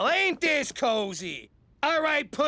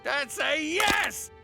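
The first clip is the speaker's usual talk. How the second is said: slow, drawn-out happy yell; joyful shout; 5 semitones higher, much slower than usual. Acted. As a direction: cheer it out.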